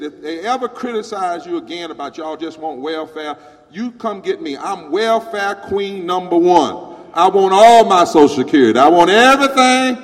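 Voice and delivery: building to a loud, high shout; loud climactic retold speech; shouting preacher-style. Lady's climax, crowd roaring.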